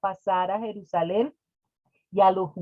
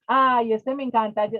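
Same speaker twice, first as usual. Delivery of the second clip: ordinary talk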